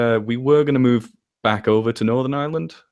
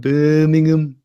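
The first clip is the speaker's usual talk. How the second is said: higher, put-on Birmingham accent; 5 semitones higher, 4 dB louder, slower than usual. Repeating a viewer's comment, mock Birmingham accent. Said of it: "laughing at that Goblin's comment".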